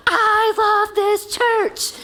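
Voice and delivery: put-on high, drawn-out child's declaration; excited three-year-old. Little boy bursting into the sanctuary, excited. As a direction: gleefully proclaim it like a little kid. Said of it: "he came running into the room".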